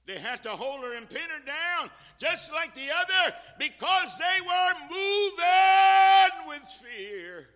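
high shout rising into a long, almost sung cry; full-force preaching. Real, not acted. Preacher on daughters pinned down at the fire, shouted preaching. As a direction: build to a climax and hold the key word out.

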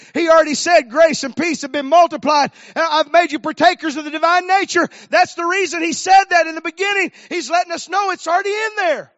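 loud, high, driving preacher voice; full-force preaching. Real, not acted. Preacher explaining grace multiplied to the congregation, impassioned preaching. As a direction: proclaim it to the whole church.

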